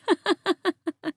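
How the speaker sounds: high rippling laugh; a run of high, light giggles. Acted. Laughs after the frog tale.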